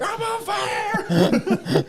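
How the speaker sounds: high, slow laughing wail; hammed-up burning guy cry; mock panic. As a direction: squeal it like a man on fire. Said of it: "the guy's like".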